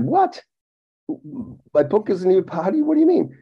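high-pitched, baffled questioning; re-enacted stunned confusion, voice jumping high; incredulous. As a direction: pitch shoots up in acted disbelief. Disbelief at news about his book.

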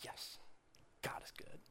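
breathy whispered yes; hushed, delighted whisper; whispered cheer. Retold or imitated. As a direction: whisper it like a private thrill. Excited churchgoer's inner cheer.

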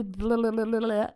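tongue-flapping mock babble; imitating a mouth running away with itself; babbled blah-blah. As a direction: spill it out as rapid nonsense blahs. Imitates words spilling out of mouth.

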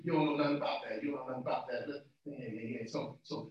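low, soft tossed-off tease; quiet aside. Real, not acted. Preacher teasing congregation about old hymns, quiet aside. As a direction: drop right down, almost to yourself.

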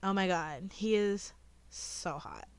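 slow, swooning, dreamy; lightly mock swooning fan girl; infatuated. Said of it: "this is the line delivery".

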